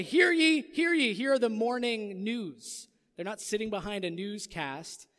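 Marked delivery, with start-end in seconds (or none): 0.0-2.9 loud, high herald proclamation; town crier. Acted. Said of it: "they would blow a bugle and say".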